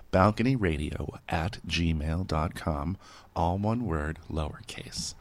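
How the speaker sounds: measured address read, dropping soft at the end; slow dictation. Shown as a demonstration. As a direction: repeat it slowly for someone writing it down. Repeats address slowly for listeners.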